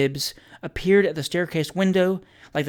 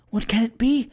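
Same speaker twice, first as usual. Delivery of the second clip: high-pitched anxious woman's voice; flustered landlady, pitched up; bewildered alarm; 8 semitones higher than usual. A narrator's reading. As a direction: exclaim in high, fretful wonder. Bewildered landlady, frightened of discovery.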